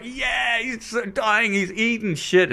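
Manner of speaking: high mock cheer without shouting; excited mob, imitated. Audience member relishing the act's failure, mock excited cheer. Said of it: "it's very exciting as an audience member".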